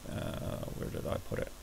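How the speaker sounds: half-whispered aside while searching; quietly murmur, just for yourself; muttering to himself. Real, not acted.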